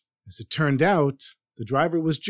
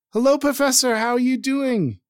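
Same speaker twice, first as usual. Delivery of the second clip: bright, much higher, louder hello; joyful greeting; 11 semitones higher, 4 dB louder than usual. Retold or imitated. A grateful driver calling the professor, overjoyed. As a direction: greet him like the best news ever.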